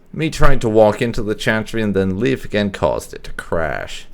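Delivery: ordinary delivery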